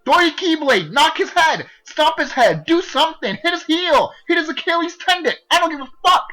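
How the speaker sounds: high, loud exasperated commands; frustrated yelling. Retold or imitated. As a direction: scream advice at the screen. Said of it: "I was like".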